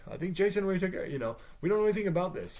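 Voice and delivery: lightly voiced frightened sister; pleading denial. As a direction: insist you're innocent, flustered. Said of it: "And he's like".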